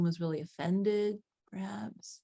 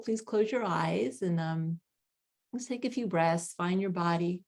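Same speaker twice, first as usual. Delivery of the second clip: calm grounding exercise. Acted. Leads a grounding meditation.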